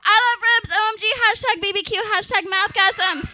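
high, loud goofy gushing; hopeless Flesh Hannah. Acted. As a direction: blurt it out like an awkward fan. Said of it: "Watch this".

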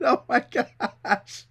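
soft, slow words sunk in laughter; worn out from laughing; laughing to tears. Real, not acted. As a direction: trail into quiet helpless laughing. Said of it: "I'm almost crying over here".

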